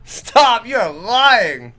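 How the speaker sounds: loud protest. Real, not acted. Host calling out co-host over Thanksgiving food, loud protest. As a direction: cry out in playful disbelief.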